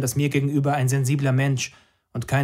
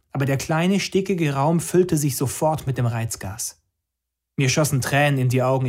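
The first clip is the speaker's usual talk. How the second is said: ordinary delivery, nothing marked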